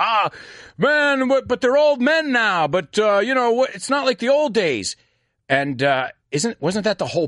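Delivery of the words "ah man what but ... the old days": high-pitched mock grumbling of moviegoers; complaining critics. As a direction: imitate disappointed fans, pitched well up. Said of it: "got a lot of, um, a lot of criticism from people".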